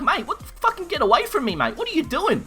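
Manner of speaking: high-pitched mock panic; retold alarmed protest. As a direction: fend him off in a high, alarmed retelling. Retells fending off naked mate.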